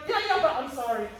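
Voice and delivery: mock backpedaling apology. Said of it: "then they're like".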